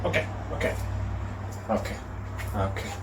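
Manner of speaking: breathy whisper, barely voiced; whispered okay. Real, not acted. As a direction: whisper it to yourself. Calming himself before recording.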